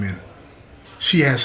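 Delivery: plain reading voice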